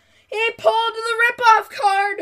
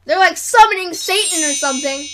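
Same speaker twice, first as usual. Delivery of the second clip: loud, hammed-up outrage; mock outraged rant; 9 dB louder than usual. Ranting at kids running in circles, loudly and hammed-up. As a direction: yell it like a mock scandalised parent.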